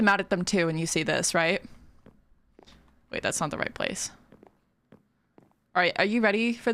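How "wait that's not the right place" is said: whispered and much softer; whispered aside. Real, not acted. Streamer catches her own mistake, whispering to herself. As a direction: whisper a correction to yourself. Muttering to self mid-build.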